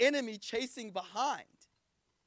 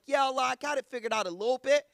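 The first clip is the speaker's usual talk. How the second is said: casual swagger; 7 dB louder than usual. Retold or imitated. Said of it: "I'm kinda like".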